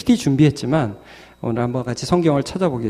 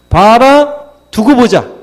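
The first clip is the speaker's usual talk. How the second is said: raised, louder challenge; defiant; 8 semitones higher, 9 dB louder, slower than usual. Acted. Believer answering the mocking enemy, defiant. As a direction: throw down a challenge to the foe. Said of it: "한마디로 하면 두고 보자예요".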